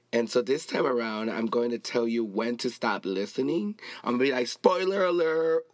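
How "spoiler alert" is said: high, held alarm call; mock siren warning; siren-like alert. Shown as a demonstration. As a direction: sound it out like a phone alarm. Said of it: "it sounds like ... like a siren".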